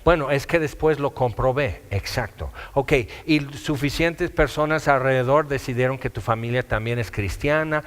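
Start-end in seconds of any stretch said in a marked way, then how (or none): none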